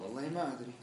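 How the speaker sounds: quiet, embarrassed retold reply; sheepish admission. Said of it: "قال". (إقرار خجول بصوت خافت)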